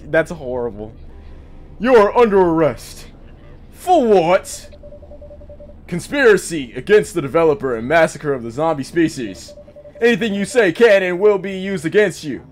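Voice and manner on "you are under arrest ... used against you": loud, commanding cop voice; arresting officer. Acted. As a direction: bark it like a stern policeman.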